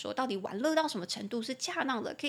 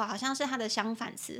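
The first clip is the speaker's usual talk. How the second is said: usual speaking voice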